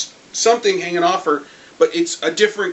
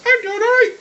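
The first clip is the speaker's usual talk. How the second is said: much higher, squeaky mock teen girl; mock girly voice; 4 dB louder than usual. Husk answering her brother, squeaky mock voice. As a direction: pitch it way up like a teenage girl.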